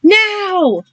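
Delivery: high, loud command; urgent screamed cue. Acted. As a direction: scream the signal.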